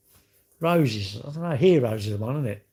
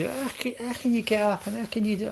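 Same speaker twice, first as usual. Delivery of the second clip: high, fast, amazed voice for the daughter-in-law; imitating daughter-in-law; 9 semitones higher, 3 dB quieter than usual. Mimicking the daughter-in-law's high, fast disbelief at early rising. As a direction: pitch up and rush it in disbelief.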